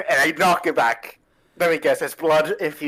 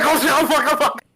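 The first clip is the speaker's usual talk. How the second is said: mock panic; 10 semitones higher than usual. Panicked reaction to mimic.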